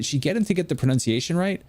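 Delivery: ordinary talk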